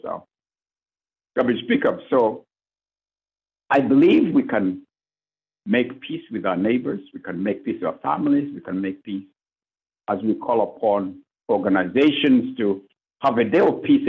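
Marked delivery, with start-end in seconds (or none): none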